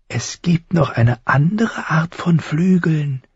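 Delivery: high, slow crow voice; high, thin voice. A narrator's reading. Crow tells boy about wings, high and thin. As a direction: explain slowly in a thin bird voice. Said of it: "Die Stimme klang hoch und dünn".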